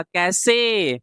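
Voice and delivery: ordinary talk, nothing marked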